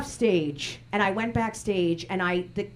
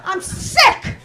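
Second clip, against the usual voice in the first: put-on high, loud shrieking old lady rant; screaming grandmother; 11 semitones higher, 4 dB louder than usual. Grandmother berating her comic grandchild after a bombed show, high screaming rant. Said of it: "Screaming on the top of her".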